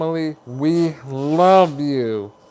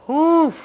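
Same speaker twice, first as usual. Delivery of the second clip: overwhelmed whew; 12 semitones higher, 4 dB louder than usual. Real, not acted. Overwhelmed by chat.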